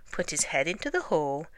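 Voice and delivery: ordinary delivery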